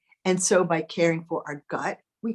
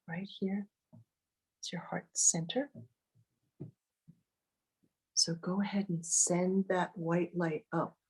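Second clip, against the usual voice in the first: guided meditation; 10 dB quieter than usual. Acted. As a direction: ease them into calm, close and gentle. Leads a meditation.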